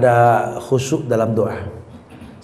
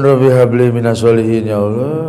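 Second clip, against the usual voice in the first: pleading prayer; 6 semitones lower than usual. Shown as a demonstration. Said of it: "Hujan turun".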